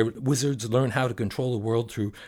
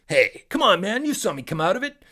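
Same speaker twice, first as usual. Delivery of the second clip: high, loud indignant genie; 9 semitones higher, 5 dB louder than usual. Acted. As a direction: protest like an offended genie. Genie voiced in story.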